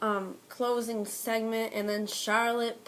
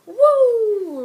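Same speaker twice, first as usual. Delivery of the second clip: loud high woo; screaming wrestler woo; Flair woo; 12 semitones higher, 13 dB louder than usual. Retold or imitated. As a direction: belt out a Ric Flair woo.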